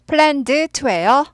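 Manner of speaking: "planned to" said fully and clearly; careful full form. Shown as a demonstration. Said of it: "어떻게 그렇게 읽어요".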